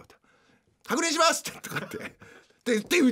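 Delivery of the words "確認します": very high staff phrase; shrill service voice. Acted. Mother at café checks order, high shrill service voice. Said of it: "すごい甲高い声で".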